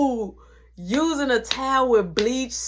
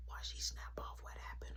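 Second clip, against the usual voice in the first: hushed secret whisper so mom can't hear; 24 dB quieter, faster than usual. Retold or imitated. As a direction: breathe it out with no voice, scared of being caught. Brother hiding talk from mom.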